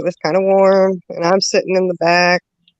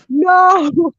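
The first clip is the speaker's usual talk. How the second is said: alarmed no; 12 semitones higher than usual. Retold or imitated. Trapped in the back seat as nephew is about to vomit, panicked cry. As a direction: cry out in dread of what's coming.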